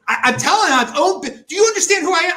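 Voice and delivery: the frustration boils over as it builds; rising outburst. Real, not acted. Recalling the doctor who didn't get it, building exasperated shout.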